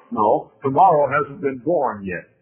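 ordinary talk, nothing marked

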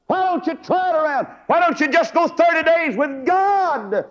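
high-pitched, impassioned pulpit cry; full-force preaching. Real, not acted. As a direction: preach it right up at the top of the range. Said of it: "that's the only way I know how to preach".

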